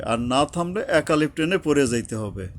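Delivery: ordinary delivery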